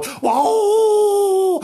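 long, high, loud wailing howl; mournful howl. A narrator's reading. Hungry Frank in the middle of another howl, mournful wailing. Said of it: "middle of another howl".

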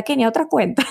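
teasing a friend through a laugh; laughing tease. Retold or imitated. Host teasing Kenia about yet another account, laughing.

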